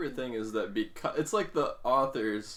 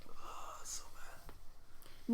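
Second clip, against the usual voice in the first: hushed disgusted whisper; 15 dB quieter, much slower than usual. Real, not acted. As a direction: breathe it out in a whisper.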